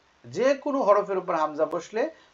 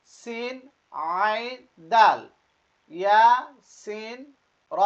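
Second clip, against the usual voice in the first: each letter name higher and drawn out; letter-by-letter recitation; 6 semitones higher, 9 dB louder, much slower than usual. Shown as a demonstration. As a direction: recite slowly for learners to repeat, pausing between letters. Leads learners reading along. (টেনে টেনে হরফ পড়া)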